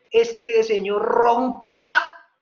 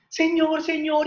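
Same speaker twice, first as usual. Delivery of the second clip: happy; 6 semitones higher than usual. Acted. Grateful mother returns to the innkeeper, happy and excited. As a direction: call out joyfully in a high voice. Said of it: "y toda feliz".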